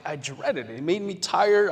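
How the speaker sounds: normal talking voice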